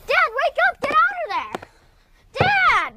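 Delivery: put-on desperate child yelling Dad; frantic calling. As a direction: scream for him to wake up. Boy trying to wake passed-out father.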